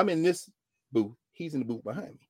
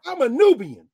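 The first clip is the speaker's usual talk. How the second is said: proud declaration; 12 semitones higher, 11 dB louder than usual. Retold or imitated.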